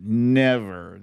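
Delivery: usual speaking voice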